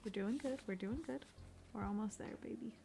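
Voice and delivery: very soft, hushed comforting; tender, near-whispered reassurance; mock soothing. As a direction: gently shush a crying baby. Soothes as if to crying baby.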